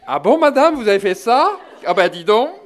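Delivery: high, loud astonished exclamation; mock amazement. Un voisin découvre les péchés de la dame, étonnement amusé. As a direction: gasp in amused shock at her confession. Mock bystander reacting amused.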